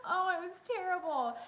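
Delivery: high, loud, teary oh it was terrible; half-crying. Real, not acted. Mom recalling the bloody mishap, half-crying. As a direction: say it through a laughing sob. Laughing, near tears.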